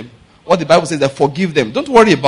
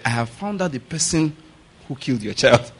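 softly confiding, lower at the end; quiet, grave tip-off; neighbour bringing news; 3 semitones lower, 7 dB quieter than usual. Acted. Woman reporting the killer to a mother, hushed. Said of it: "So the woman came and said".

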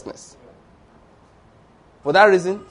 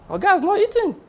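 sly tempter; 13 semitones higher than usual. Retold or imitated. Satan whispering to Martha.

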